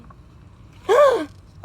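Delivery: loud, high sharp gasp of fright; frightened gasp. Acted. Little robin seeing the hidden cat, terrified gasp. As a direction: gasp as you spot the cat.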